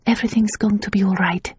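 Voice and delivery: soft whispered reassurance; calm her gently; soothing. A narrator's reading. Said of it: "Tasia soothed".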